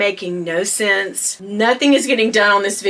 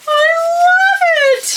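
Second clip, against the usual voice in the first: much higher, louder delighted shriek; excited shriek; 4 dB louder, slower than usual. Real, not acted. Vlogger admiring the wrapped bag, shrieking with delight. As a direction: shriek it with pure joy. Quiet then shrieked repeat.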